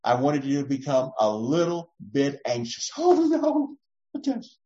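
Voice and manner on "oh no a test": mock dismay.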